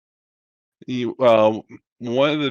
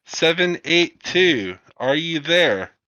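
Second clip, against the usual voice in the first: slower, higher, deliberate second hail; repeated radio hail; 6 semitones higher, 3 dB louder, slower than usual. Acted. Operator repeats the call when nobody answers, slow radio hail. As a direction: spell it out again, slowly, over the radio.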